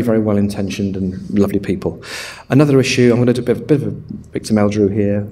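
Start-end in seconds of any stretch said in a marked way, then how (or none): none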